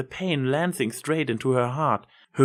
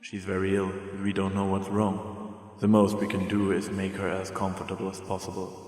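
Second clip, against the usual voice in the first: put-on a doctor gently telling a child; grave doctor; 4 semitones lower, 5 dB quieter than usual. Doctor breaks bad news.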